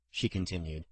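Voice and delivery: ordinary delivery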